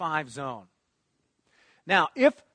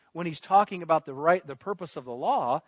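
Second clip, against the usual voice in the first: ordinary talk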